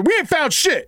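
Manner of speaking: hammed-up Spaceballs trooper shout; movie soldier quote. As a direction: bark it like a dumb trooper. Quoting Spaceballs soldier line.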